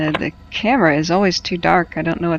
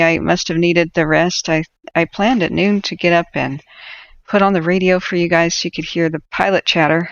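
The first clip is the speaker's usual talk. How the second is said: usual speaking voice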